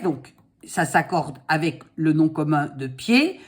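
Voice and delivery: ordinary talk, nothing marked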